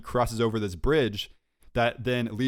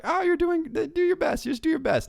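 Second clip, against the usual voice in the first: very high-pitched mock encouragement; high, sing-out imitation of a cheerleading partner; mimicked texter. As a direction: put on a squeaky-high voice for her text. Acting out her encouraging text back.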